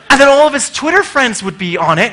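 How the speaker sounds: usual speaking voice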